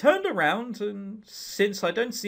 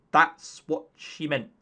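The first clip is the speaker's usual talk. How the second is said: usual speaking voice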